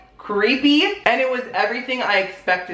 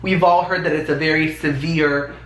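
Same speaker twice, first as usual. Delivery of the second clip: normal talking voice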